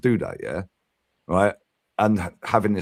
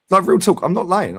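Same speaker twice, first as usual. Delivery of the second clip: raised, loud insistence; earnest protest; emphatic insistence; 8 semitones higher, 5 dB louder than usual. Real, not acted. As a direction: swear it's true, loudly. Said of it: "I said that to her".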